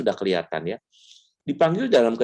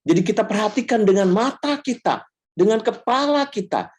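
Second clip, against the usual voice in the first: preaching voice; 12 semitones higher than usual. Shown as a demonstration. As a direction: proclaim it like a sermon to the congregation. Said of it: "kalau khotbah saya bilang". (suara berkhotbah yang lantang dan tinggi)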